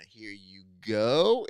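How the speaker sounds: slow, exaggerated presenting voice; mock handover. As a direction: hand it over with a long, swelling "go". Imagined organisers hand over credit.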